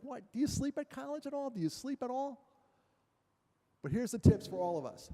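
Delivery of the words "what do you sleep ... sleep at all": much higher, disbelieving question; incredulous parent. Retold or imitated. Dad to kids home from college, incredulous and high. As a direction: sound baffled at your college kids. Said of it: "I'm like".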